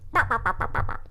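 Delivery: light chipmunk babble; mock chattering ba ba. Shown as a demonstration. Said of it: "a little chipmunk voice".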